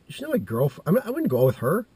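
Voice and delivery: high, loud indignant denial; indignant protest. Retold or imitated. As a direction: protest loudly at the absurd idea. Acts out indignant protest to dad.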